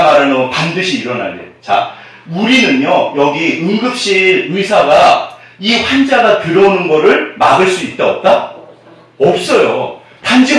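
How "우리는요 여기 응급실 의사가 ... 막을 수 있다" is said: ordinary talk, nothing marked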